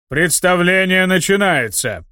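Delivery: grand showman's call, slowed; solemn announcement. A narrator's reading. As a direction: announce it like a ringmaster. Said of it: "торжественно объявил Аарам".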